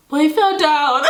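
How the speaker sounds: high-pitched mock indignant explanation; re-enacted baffled insistence; imitated protest. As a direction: protest like a misunderstood child. Protesting to classmates, acted.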